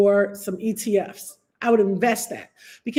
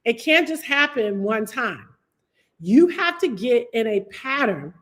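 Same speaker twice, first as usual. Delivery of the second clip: ordinary delivery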